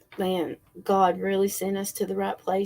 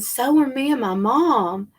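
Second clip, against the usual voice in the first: put-on excited boy; 5 semitones higher, 6 dB louder than usual. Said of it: "And Jackson's face lit up".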